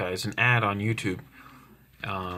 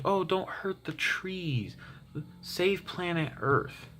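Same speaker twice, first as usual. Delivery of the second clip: high, slow mock-sweet plea; mock gentle pleading; 9 semitones higher, 4 dB quieter, slower than usual. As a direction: parody a peace lover in a high, drawn-out voice. Mocking peaceful early verses.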